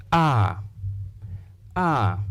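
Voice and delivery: AH held long and open, falling away; a long, drawn-out "ah" sound; long AH vowel. Shown as a demonstration. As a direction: draw out a long "ah". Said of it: "have the long vowel sound".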